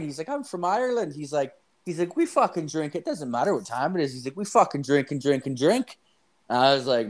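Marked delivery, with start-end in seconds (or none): 0.3-1.1 mock Irish old man, pitched much higher; lightly hammed-up Irish drinker voice; old Irish guy. Imitating Tommy the old Irish drinker, high-pitched. 2.2-4.1 mock Irish old man, pitched much higher; lightly hammed-up Irish drinker voice; old Irish guy. Imitating Tommy the old Irish drinker, high-pitched. 4.4-6.0 mock Irish old man, pitched much higher; lightly hammed-up Irish drinker voice; old Irish guy. Imitating Tommy the old Irish drinker, high-pitched.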